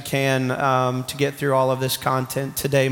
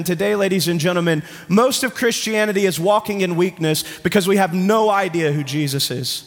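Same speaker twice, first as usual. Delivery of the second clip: ordinary delivery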